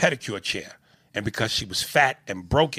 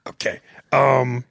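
ordinary talk, nothing marked

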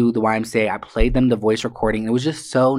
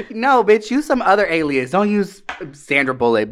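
playful scold; 7 semitones higher than usual. Real, not acted.

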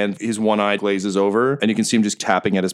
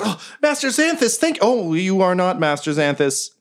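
very high, startled little robot; 10 semitones higher than usual. Acted. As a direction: squeak it out like a panicked droid.

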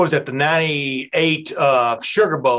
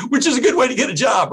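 laughing; 8 semitones higher, faster than usual. Real, not acted. Laughing at own joke.

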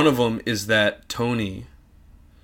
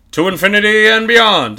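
high, loud heroic toy cry; space ranger voice; 9 semitones higher, 7 dB louder than usual. Acted. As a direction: proclaim like a brave space toy. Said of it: "That's my buzz".

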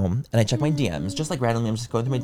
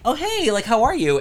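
bright surprised greeting, pitched way up; high excited hello; 11 semitones higher than usual. Retold or imitated. Greeting the guy from the gym, pitched way up and surprised. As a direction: gush a surprised hi.

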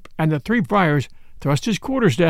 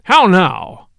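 louder indignant outburst; loud, angry; 6 dB louder than usual. A narrator's reading. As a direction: demand an answer in anger. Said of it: "in a loud and angry voice".

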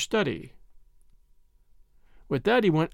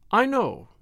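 higher, vaguely spoken reply; rather vague; 6 semitones higher than usual. A narrator's reading. Priest answering the Major about the burglar, rather vague. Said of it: "rather vaguely".

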